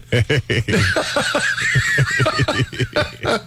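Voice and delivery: long, high fit of real laughter; helpless laughter. Real, not acted. Co-host cracking up when let off the hook, helpless laughter. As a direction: laugh on and on, unable to stop. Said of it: "I can't stop laughing".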